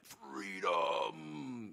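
drawn-out mock battle cry in bad Scottish; Mel Gibson, bad Scottish accent. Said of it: "Mel Gibson with a really bad Scottish accent".

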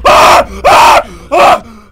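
sharp, piercing screams of fright; terrified screams. Real, not acted. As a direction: scream in pure shock at the monster. Jump scare in horror game.

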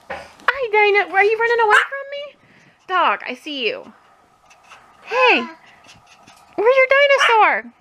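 high sing-song pet voice; baby talk to pet. Acted. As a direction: coo at the puppy. Dad baby-talks to dog.

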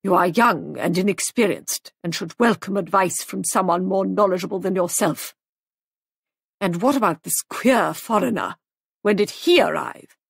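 understated huffy matron; mild indignation. A narrator's reading. Offended guest lecturing the young hostess, mildly indignant. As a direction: scold with a touch of anger. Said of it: "Missus Boyle flushed angrily".

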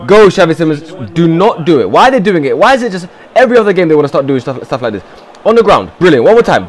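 ordinary talk

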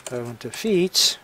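usual speaking voice

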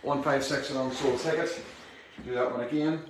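ordinary talk

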